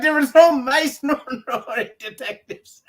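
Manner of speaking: high, loud protest breaking into laughter; retold indignation, amused underneath; mock outraged complaint. Acted complaint about misleading film.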